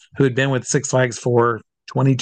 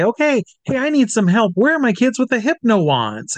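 put-on high showman's call to the kids; 9 semitones higher than usual. Hypnotist asks the audience where his kids are, calling out. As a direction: pitch it up, rallying the children.

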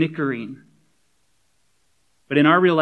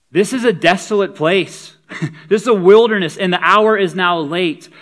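mock exasperated complaint; 5 semitones higher, 8 dB louder than usual. Disciples complain about a late hour.

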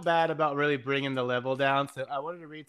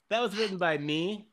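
ordinary talk

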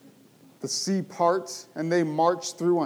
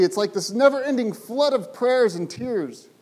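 normal talking voice

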